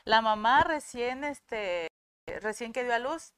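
usual speaking voice

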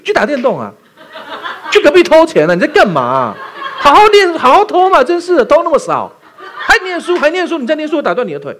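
mock scolding parent. A parent yelling at a child who won't misbehave, mock-exasperated and high. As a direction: play an exasperated mom scolding her kid for studying, half laughing.

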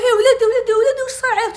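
high, loud frantic cry; alarmed mother. Retold or imitated. Mother seeing her hurt son, frantic loud cry. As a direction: wail in alarm for your child.

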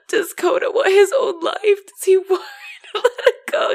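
high, loud, half-sobbing questions; tearful laughing. Real, not acted. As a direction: push through tears and laughter. Tearful retelling of sad movie.

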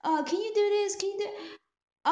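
high, hesitant favor-asking; pestering caller. Retold or imitated. Speaker imitates a caller asking favors, high pleading. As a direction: imitate someone piling up requests in a high voice. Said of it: "somebody call you and say".

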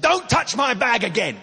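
high-pitched outraged yell; acted shout, far above normal voice. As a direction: imitate a furious passenger shouting a warning. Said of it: "she shouted at this older".

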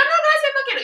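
very high, quick brush-off; retold refusal. Tourist brushes off the rose seller, high mock refusal.

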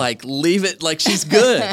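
loud, high mocking brush-off; mock dismissive. Retelling his wife's brush-off, mock dismissive and loud. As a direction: toss it off like she means it.

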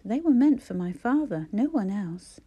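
sting her with every word; cold stinging. A narrator's reading. Jonah condemning Clara for reading private diaries, cold and stinging. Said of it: "His voice was cold and stinging".

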